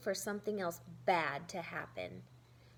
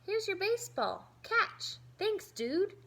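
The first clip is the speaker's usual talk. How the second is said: put-on lively kid voice; 9 semitones higher, 4 dB louder than usual. Kids playing at recess.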